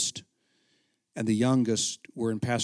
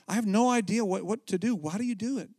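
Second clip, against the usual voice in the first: desperate plea; 9 semitones higher than usual. Retold or imitated. His desperate question to Don.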